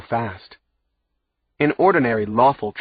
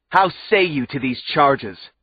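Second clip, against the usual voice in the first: yelled; 5 semitones higher, 4 dB louder than usual. A narrator's reading. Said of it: "he yelled at us".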